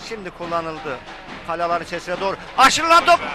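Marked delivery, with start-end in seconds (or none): 2.6-3.2 screaming football commentator. Real, not acted. Goal about to be scored.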